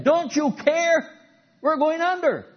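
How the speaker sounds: high, loud, slow desperate plea; panicked cry. Acted. Disciples waking Jesus in the storm, panicked high cry. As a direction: cry out as the boat sinks.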